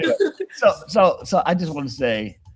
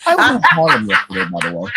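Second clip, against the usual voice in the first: Coco impression; 7 semitones lower, 5 dB louder than usual. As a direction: do Coco excitedly telling the story. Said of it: "here go Coco".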